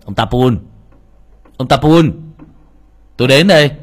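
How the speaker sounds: loud call toward the house. A narrator's reading. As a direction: shout the name across the clearing. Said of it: "gã mới gọi".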